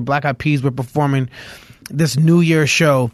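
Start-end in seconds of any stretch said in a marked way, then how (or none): none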